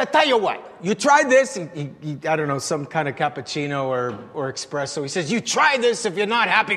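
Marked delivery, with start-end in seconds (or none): 0.0-1.5 higher, louder Italian papa with a laugh; hearty Italian papa, raised and louder; café owner voice. Retold or imitated. Café owner offering coffee. 5.3-6.8 café owner voice. Retold or imitated. Café owner offering coffee.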